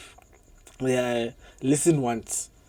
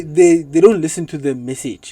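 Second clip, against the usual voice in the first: ordinary talk, nothing marked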